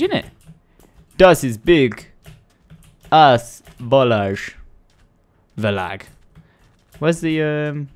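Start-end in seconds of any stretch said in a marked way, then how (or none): 1.2-4.6 put-on slow, silly German accent; mock German.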